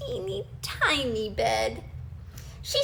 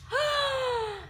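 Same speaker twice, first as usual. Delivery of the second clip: a sharp high gasp of shock; 12 semitones higher than usual. A narrator's reading. Narrator gasping as the chair breaks. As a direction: gasp as if startled.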